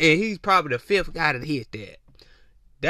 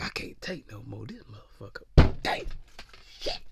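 low, very soft breathy muttering; mock overwhelmed whisper; 7 semitones lower, 6 dB quieter than usual. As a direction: mutter helplessly under your breath, put-on. Imitating lady overcome by desire.

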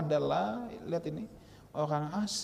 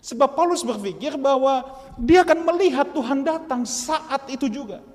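higher, louder sermon line; emphatic preaching; 11 semitones higher, 11 dB louder than usual. Acted. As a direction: lift the congregation. (khotbah penuh penekanan)

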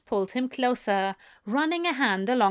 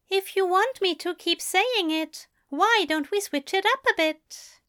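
higher, playful and warm; adoring angel; 10 semitones higher than usual. A narrator's reading. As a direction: tease with adoration. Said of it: "warm and adoring".

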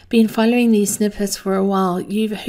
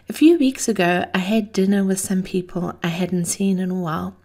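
usual speaking voice

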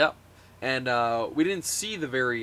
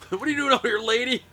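high mocking wrestler voice rising to a loud shout; mock Jericho yell; 7 semitones higher, 7 dB louder, faster than usual. Podcaster mocking the ring announcer, mock wrestler shout. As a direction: bark at the ring announcer to get out.

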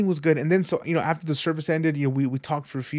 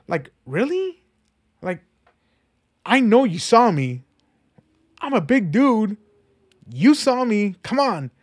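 loud, high, hurt indignation at being ignored; indignant disbelief; 7 semitones higher, 5 dB louder, slower than usual. Retold or imitated. Snubbed man to his old friend, loud indignant disbelief.

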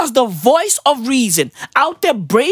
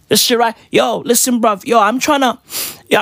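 usual speaking voice

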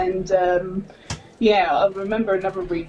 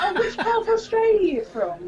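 higher, laughing mock-curious Canadian; imitating a Canadian; 10 semitones higher than usual. Retelling Canadians who think she's Australian, mock curious and laughing. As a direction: play the cheerful, clueless local. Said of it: "they're like".

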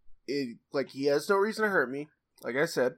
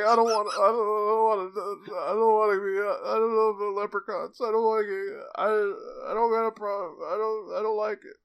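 put-on higher, louder wailing kid voice; imitating crying kid; 7 semitones higher, 4 dB louder than usual. Kid to daycare leprechaun, imitating a higher, louder sobbing wail. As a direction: mimic a little kid sobbing his protest. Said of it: "I started crying, like, bawling".

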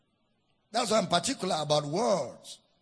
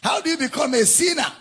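called-out question; 6 semitones higher, 3 dB louder, faster than usual. Real, not acted. Preacher calls question out.

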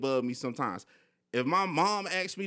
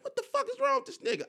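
much higher, mock baffled friend voice; imitating homegirl; faster than usual. Homegirl hearing him rant for an hour, high mock disbelief. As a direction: play the shocked friend, pitched way up.

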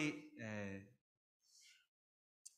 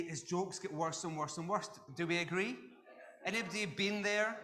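ordinary talk

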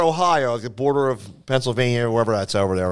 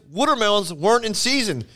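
exasperated club owner; 8 semitones higher than usual. Retold or imitated. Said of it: "and he goes".

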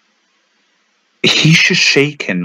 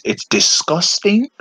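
high, slow disgust; 10 semitones higher, slower than usual. Real, not acted. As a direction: stretch it out in disgust. Ranting about hand washing.

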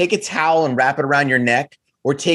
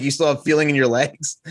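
ordinary delivery

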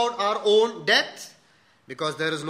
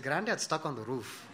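much softer and lower, laughing through it; deadpan punchline; 5 semitones lower, 10 dB quieter than usual. Retold or imitated. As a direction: deliver the punchline low, holding back a laugh. Punchline, friend applies lesson.

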